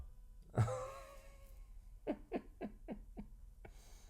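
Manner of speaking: breathy silent laugh; shaking with quiet laughter; unvoiced giggle. Real, not acted. Laughing at teen memory.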